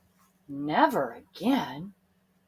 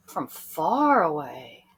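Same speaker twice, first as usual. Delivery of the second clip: usual speaking voice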